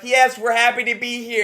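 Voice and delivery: mock crowd voice. Coach voicing the audience, mildly cheerful. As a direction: answer like a pleased crowd. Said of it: "They're like".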